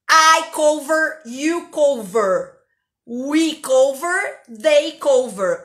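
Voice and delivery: slow, loud "I cover, you cover"; drawn-out pointing drill; teacher slowly chanting the conjugation. Shown as a demonstration. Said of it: "Faz aí, meu filho".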